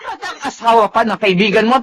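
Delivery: normal talking voice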